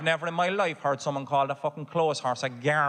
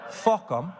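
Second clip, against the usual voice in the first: punchier repeat; 3 semitones higher than usual. Shown as a demonstration. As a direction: land the "Fuck 'em" with force. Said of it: "that was absolutely beautiful".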